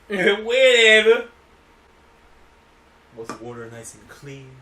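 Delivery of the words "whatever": loud, high, drawn-out whatever; mocking echo. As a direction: stretch it out in an exaggerated echo. Listener mockingly echoes the speaker's word.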